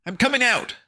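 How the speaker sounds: high, loud yell; desperate yell. Acted. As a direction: shout it through the door. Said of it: "Fancy Pants yelled".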